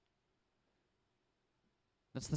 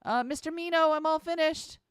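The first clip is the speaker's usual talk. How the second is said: put-on much higher, chirpy girl voice; bubbly girl; 7 dB louder than usual. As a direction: pipe up brightly. Cheerful girl interrupts.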